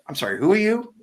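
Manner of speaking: pitched high, baffled politeness; puzzled question. Retold or imitated. Mike Patty asks the stranger who he is, high and baffled. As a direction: sound lost, with no idea who this is.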